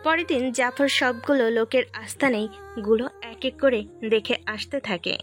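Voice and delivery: usual speaking voice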